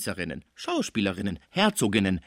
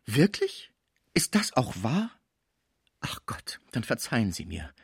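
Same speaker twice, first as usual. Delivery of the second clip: tearful reply; 2 semitones higher than usual. A narrator's reading. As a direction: start in hopeful disbelief, end hushed and tearful. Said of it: "erwiderte sie weinend". (weinerlich, gerührt)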